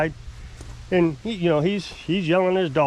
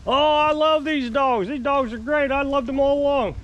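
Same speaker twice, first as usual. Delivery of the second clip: mock gushing; 8 semitones higher than usual. Mimicking the neighbor claiming to love dogs, mock gushing. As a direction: drag out the fake gushing up high.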